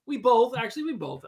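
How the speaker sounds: normal talking voice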